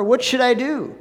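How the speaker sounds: high, loud and desperate; helpless scream. Acted. The representative crying out to the farmer, high helpless plea. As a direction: plead for rescue in a panic. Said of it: "He screamed this helplessly".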